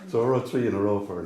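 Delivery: ordinary talk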